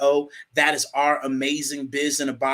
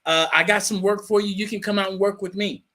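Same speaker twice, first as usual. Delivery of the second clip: lightly voiced dad, pitched higher; a hint of Dad's voice, raised in pitch; imitating dad; 6 semitones higher than usual. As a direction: quote a father offering his son a hand. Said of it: "my dad was like".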